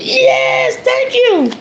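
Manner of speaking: put-on high eager little-kid yes; childlike high thank-you. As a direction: squeal it out like a grateful child. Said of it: "like a little kid".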